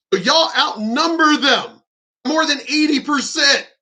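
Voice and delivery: fired-up shouted challenge; ranting shout. Real, not acted. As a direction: yell it as the punchline of the rant. Ranting at women in the audience.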